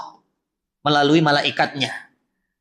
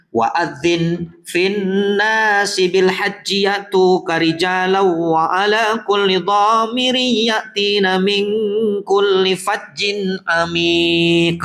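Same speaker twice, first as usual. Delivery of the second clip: Quran recitation; much slower than usual. Acted.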